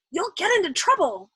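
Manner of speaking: ordinary delivery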